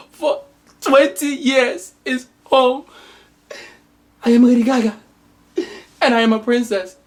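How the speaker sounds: high, overacted weepy declarations; hammed-up crying. Mother and daughter reunited, hammed-up crying. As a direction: blubber it like a soap finale.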